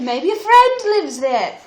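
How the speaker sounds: high, loud hopeful dwarf; cheery Happy. Acted.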